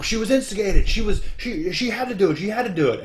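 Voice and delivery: high, loud mock commentary; excited commentator imitation. Mimicking two commentators' excited defence.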